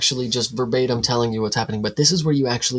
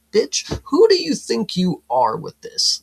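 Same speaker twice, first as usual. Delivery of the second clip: mock outrage; 8 semitones higher, 4 dB louder, slower than usual. Mock outrage at the bar owner.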